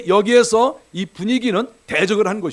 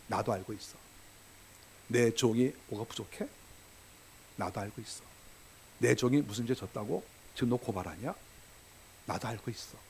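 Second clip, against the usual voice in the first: low, soft and hushed, barely above a murmur; God calmly telling the accuser he already knows; a quiet, knowing God, hushed and low; gentle voice of God; 8 semitones lower, 12 dB quieter than usual. Acted. God speaks softly, voiced.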